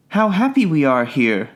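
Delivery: lightly voiced happy children; a touch of delight among playmates; mildly excited children's cry. A narrator's reading. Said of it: "they cried to each other".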